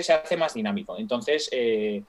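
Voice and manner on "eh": ordinary delivery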